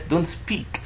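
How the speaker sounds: ordinary talk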